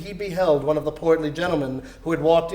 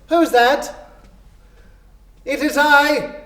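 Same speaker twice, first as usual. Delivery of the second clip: higher, louder call; called question; 8 semitones higher, 5 dB louder than usual. Acted. Fred calling out who has come, loud. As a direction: call across the hall. Fred calls out, Scrooge replies.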